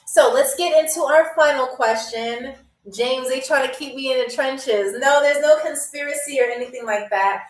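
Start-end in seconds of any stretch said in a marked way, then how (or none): none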